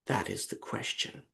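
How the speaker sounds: lightly voiced Harriet Walter; imitating Harriet Walter. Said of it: "who says".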